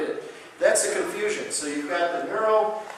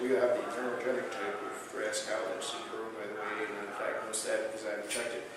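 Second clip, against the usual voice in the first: lower, softer, self-important doctor voice; show-off doctor; 5 semitones lower, 10 dB quieter than usual. Retold or imitated. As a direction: mimic a doctor trying to impress a patient. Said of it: "wow the patient".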